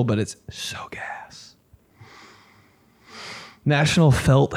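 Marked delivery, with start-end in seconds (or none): 0.0-1.5 slow, hushed whisper; awed whisper. Real, not acted. Raving about simple cinnamon butter toast, awed whisper. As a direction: whisper it in drawn-out awe.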